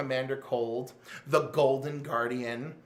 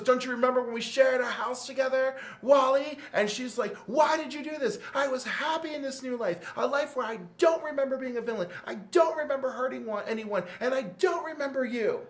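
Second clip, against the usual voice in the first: high, louder, wounded mock outburst; hurt accusation; 8 semitones higher, 3 dB louder than usual. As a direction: throw the betrayal back at him. Magenta accusing Wally while fighting.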